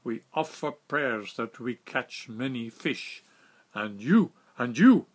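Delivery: slow, rising to loud "And you, and you"; accusing shout. Retold or imitated. Captain blaming the narrator for the empty nets, rising loud accusation.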